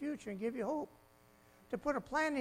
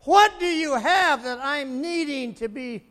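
loud slow plea; 4 semitones higher, 12 dB louder, slower than usual. Acted. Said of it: "the world stands in line ... and says".

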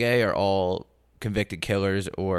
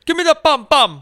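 high loud put-on accent; mock Jamaican shout; 8 dB louder than usual. Jokey Jamaican-style shout.

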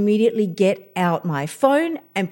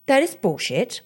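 normal talking voice

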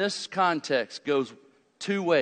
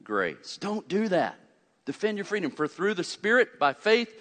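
ordinary talk

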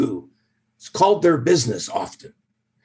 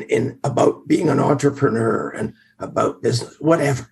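ordinary talk

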